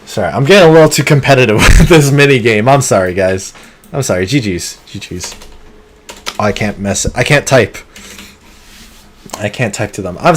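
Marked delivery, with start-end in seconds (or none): none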